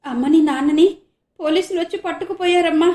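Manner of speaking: crying hard. Acted. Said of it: "బోరున ఏడుస్తూ అంది మాధవి".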